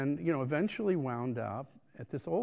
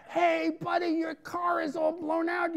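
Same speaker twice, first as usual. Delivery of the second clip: much higher, louder mock yell from the roadside; yelling bystander; 13 semitones higher, 4 dB louder than usual. Passerby yells at driver with burst tires, mock shout. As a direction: bellow at the stranded driver like a passing local. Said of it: "people were yelling at me".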